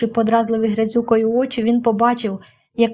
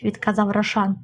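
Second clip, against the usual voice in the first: ordinary delivery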